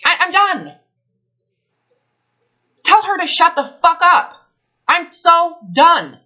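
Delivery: fed up and letting it show, voice climbing; real anger. Real, not acted. Therapist fed up with client's inner critic, angry and high. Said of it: "I'm so mad right now".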